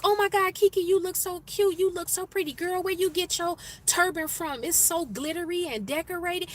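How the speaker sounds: high-pitched, excited mock compliments; gushing admirers. Women complimenting a coworker's glittery turban, gushing.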